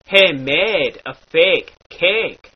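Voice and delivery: "made" and "cake" slow and drawn out; stretched A. Shown as a demonstration. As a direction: linger on the A vowels. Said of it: "is stretched out a bit".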